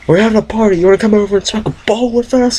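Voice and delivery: squeaky silly voice; high put-on voice. Invite in put-on voice.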